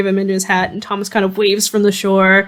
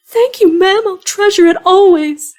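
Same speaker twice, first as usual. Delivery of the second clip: put-on much higher, awestruck boy voice; enamored kid; 11 semitones higher than usual. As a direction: thank her like a smitten little boy. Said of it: "he looks enamored".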